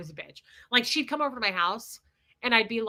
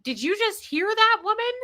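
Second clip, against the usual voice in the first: incredulous; 11 semitones higher, 4 dB louder than usual. Retold or imitated. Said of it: "I said".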